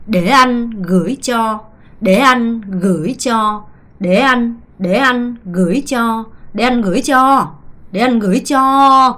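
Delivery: "cho" held long at the end of "Để anh gửi cho"; drawn-out cho. Shown as a demonstration. Teacher stretches "cho" so students copy it. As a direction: drag out "cho". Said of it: "끌어야 돼요".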